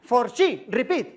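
"for she" drawn out slowly as paella; slow fake-English pun; mock English. Shown as a demonstration. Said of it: "o sea, paella". (inglés fingido lento)

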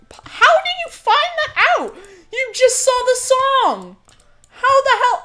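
high, loud, slowed disbelief; incredulous yelling. Real, not acted. Streamer yelling at viewers in disbelief, high and loud. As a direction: demand to know how they found out. Asking chat how they knew.

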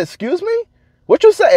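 high, incredulous excuse me then what you say; mock outraged, way up in pitch; retold offended reply to the boss; indignant disbelief. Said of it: "I said".

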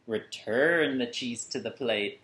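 ordinary delivery, nothing marked

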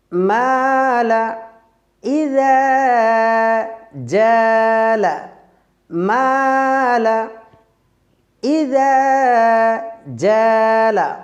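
মালা ইযা জালা chanted very slowly with thin alifs; slow thin recitation. Shown as a demonstration. Teacher recites examples with thin alif. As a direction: draw out মালা and জালা lightly.

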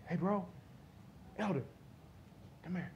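a hushed friendly call; quiet aside. Shown as a demonstration. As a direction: murmur it to someone beside you. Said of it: "if I'm close".

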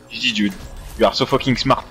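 ordinary delivery

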